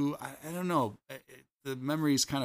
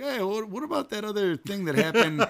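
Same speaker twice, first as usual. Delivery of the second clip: higher-pitched mock interrupting; interrupting sisters; 8 semitones higher than usual. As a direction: butt in like a teasing sister. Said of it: "they'll interrupt and be like".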